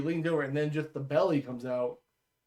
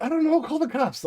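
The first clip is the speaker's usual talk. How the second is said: high-pitched exasperated comeback; retold helpless protest; exasperated retort; 12 semitones higher than usual. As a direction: throw it back, pitch jumping up. Retold exasperated reply to homeowner.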